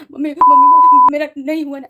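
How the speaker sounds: high, loud sobbing wail for mom; crying to mom. Retold or imitated. Phoning her mother after failing the exam, high loud sobbing wail. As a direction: wail for mom like a heartbroken child. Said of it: "ऐसा करके मैं रोने लग गई".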